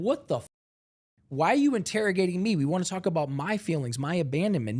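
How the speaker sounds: high, fast indignant protest; defensive outburst. Retold or imitated. Objecting to being interrogated about hitting, defensive. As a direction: push back in a rapid rising rush.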